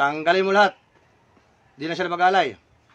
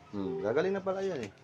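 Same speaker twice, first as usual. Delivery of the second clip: lower, much softer musing; quiet acknowledgement; 4 semitones lower, 10 dB quieter than usual. Real, not acted. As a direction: take in the answer softly. Acknowledges the answer just given.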